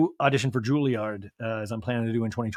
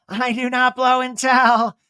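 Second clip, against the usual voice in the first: higher, loud, very slow; coy Kyle voice; 9 semitones higher, 6 dB louder, much slower than usual. Retold or imitated. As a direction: tease it out coyly.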